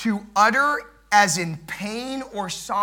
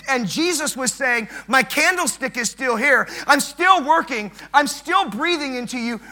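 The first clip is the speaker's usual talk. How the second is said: high, driving sermon delivery; higher-pitched pulpit exhortation; impassioned preaching; 6 semitones higher, 6 dB louder than usual. Real, not acted. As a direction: preach it with rising urgency and punch.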